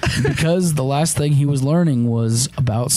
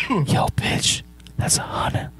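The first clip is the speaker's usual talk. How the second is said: hushed bro voice; 5 semitones higher, 5 dB quieter, slower than usual. Acted. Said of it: "And he goes".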